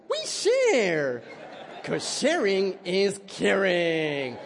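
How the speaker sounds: put-on high, cheery, sing-song kids' TV host voice, drawn out; Barney the dinosaur. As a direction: gush like a children's show dinosaur breaking up a fight. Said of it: "Barney's showing up with songs".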